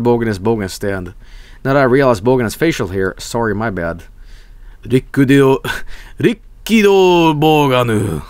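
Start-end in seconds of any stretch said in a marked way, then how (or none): 6.2-8.2 Rikkido Boganu drawn out slowly, syllable by syllable; slow, theatrical reading of Rikkido Boganu; mock exotic name. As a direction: intone Rikkido Boganu like a grand title.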